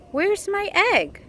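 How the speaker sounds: high startled bunny; worried little bunny. Acted. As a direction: ask it in a high anxious bunny voice. Bunny wakes up asking.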